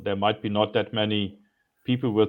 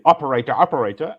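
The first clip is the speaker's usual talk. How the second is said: louder, faster, higher frustrated call; aggravated shout; 4 semitones higher, 6 dB louder, faster than usual. Retold or imitated. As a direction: demand it impatiently, twice. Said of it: "I'm getting aggravated and say".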